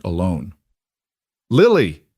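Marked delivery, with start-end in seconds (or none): 1.5-2.0 loud urgent call across the woods. Acted. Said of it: "Aaron called out".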